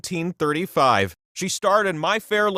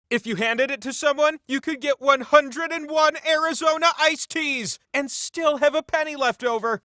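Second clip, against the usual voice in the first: excited hype; 10 semitones higher than usual. Acted. Hyping up the hundred dollar bill.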